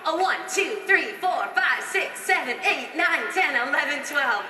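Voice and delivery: high energetic count. Shown as a demonstration. Choreographer pumping dancers through the final twelve. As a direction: call the counts high and bright. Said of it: "counting dancers again".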